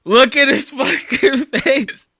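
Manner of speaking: loud, high, laughing outburst; helpless laughter through the words. Real, not acted. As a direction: crack up while pointing it out. Cracking up at frozen face.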